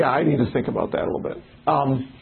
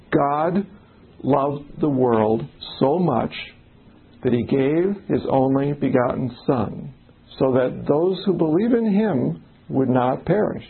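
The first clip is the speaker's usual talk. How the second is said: slow deliberate reading; slower than usual. Shown as a demonstration. Rereading the verse to the group, slow and deliberate. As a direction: read the verse slowly, word by word. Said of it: "we put the wrong emphasis".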